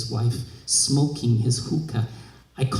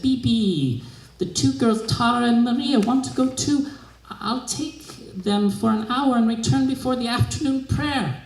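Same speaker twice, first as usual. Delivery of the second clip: higher, lightly voiced servant, polite and eager; a humble maid pleading her case, pitched up; servant asking her mistress; 8 semitones higher than usual.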